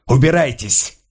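Paul on one knee drives out the guards: shout; shouted order. A narrator's reading. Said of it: "прокричал".

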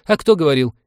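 high, loud retort; indignant navigator. A narrator's reading. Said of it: "привычно начал оправдываться навигатор".

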